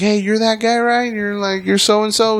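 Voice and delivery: high, sing-song fake friendliness; mock-friendly greeting. Greeting the rude customer at the counter, high and sugary. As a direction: greet him sweetly before the trap.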